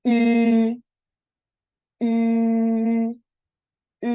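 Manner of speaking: "U" said with lips rounded as if whistling. Shown as a demonstration. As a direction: purse the lips and say "u". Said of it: "Lafalnya seperti ini".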